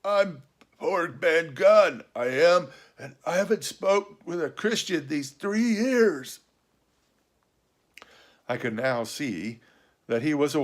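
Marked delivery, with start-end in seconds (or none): 0.0-6.4 hoarse castaway, higher and awkward; Ben Gunn. A narrator's reading. Castaway meeting his first person in years, hoarse and awkward. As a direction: creak like a rusty lock, voice long unused. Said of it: "his voice sounded hoarse and awkward".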